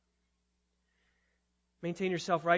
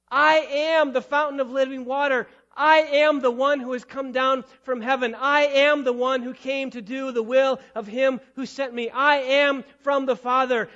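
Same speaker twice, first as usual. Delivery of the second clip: booming proclamation, each I am hammered home; proclaiming; 5 semitones higher, 11 dB louder than usual. Acted. Preacher voicing Christ to the Pharisees, booming proclamation. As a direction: announce it to the whole crowd.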